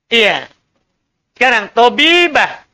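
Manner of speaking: usual speaking voice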